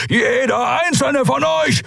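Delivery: high, drawn-out roar; thundering threat. A narrator's reading. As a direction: roar a death threat at the captors. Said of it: "donnerte Keru". (donnernd gebrüllte Drohung)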